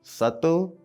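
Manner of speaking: ordinary talk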